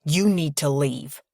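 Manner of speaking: slow, firm male character's command; restrained, weighty irritation; snapped order. A narrator's reading. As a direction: order them out, curtly stern. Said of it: "Drew snaps".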